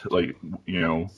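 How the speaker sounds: normal talking voice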